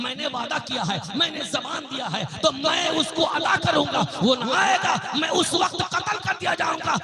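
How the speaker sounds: fervent sermon storytelling; impassioned preaching. Acted. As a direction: preach the tale with rising conviction. Preaches a story of a kept promise.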